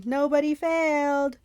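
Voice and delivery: loud, high gleeful Cyclops yell. Acted. Cyclops gloating at Percy, gleeful yell. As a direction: crow it in triumph. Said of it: "he yelled gleefully".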